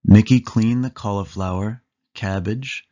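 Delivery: usual speaking voice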